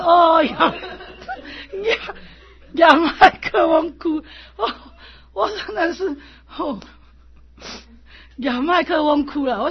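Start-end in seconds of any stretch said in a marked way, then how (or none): 0.0-4.2 hammed-up wailing into a microphone, loud and high, breaking into laughter; laughing parody of a professional funeral wail; mock mourner wailing. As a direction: imitate a hired mourner crying, half laughing. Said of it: "他说".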